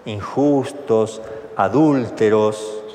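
each word drawn out slowly; slow, scornful list. Retold or imitated. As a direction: spell out the sins one by one, slowly. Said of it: "oraba así en su interior".

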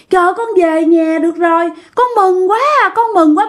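put-on high, mincing girlish voice. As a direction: play a possessed man sounding like a dainty girl. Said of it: "nói cái giọng điệu hạnh". (giọng yểu điệu như con gái)

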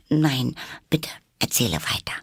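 meek, high "nein, bitte"; humble plea that starts high and settles. A narrator's reading. Alice begs the Dormouse to continue, humble. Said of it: "sagte Alice ganz bescheiden".

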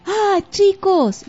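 slow, high, drawn-out "ah"; delighted greeting. Acted. Monster greets children. (saludo encantado y lento)

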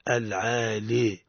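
ordinary talk

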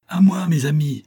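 high, loud call for help; rallying cry. A narrator's reading. Officer calls his men as he attacks, rallying shout. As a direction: summon your men to the fight. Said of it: "cria Elim".

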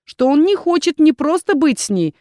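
ordinary delivery

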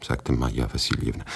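ordinary delivery

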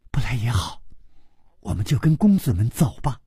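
plain reading voice

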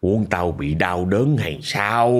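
low, slow, heavy growl of a dead grandfather; possessed old man's voice. Acted. Dead grandfather's spirit scolding the family, low slow growl. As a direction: drag it out low and menacing like a spirit speaking through someone. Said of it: "Nặng hơn, gằn hơn nữa".

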